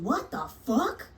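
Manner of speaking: usual speaking voice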